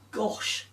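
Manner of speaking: soft whispered gasp then gosh. Acted. Friend amazed by Claire's tale, whispered gasp. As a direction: breathe out in wonder. Abigail gasps at story.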